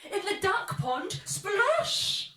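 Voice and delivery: high loud splash; excited. A narrator's reading. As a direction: build to a big splosh. Climax narration.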